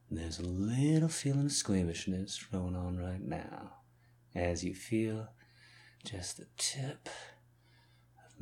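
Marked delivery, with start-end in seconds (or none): 6.1-8.4 whispering. Acted. Unvoiced whisper mid-sentence.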